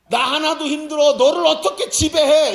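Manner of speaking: loud, high, whiny protest; mock complaining listener. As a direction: moan it like someone fed up. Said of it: "이렇게 말하지". (투덜대며 크게 항의하듯)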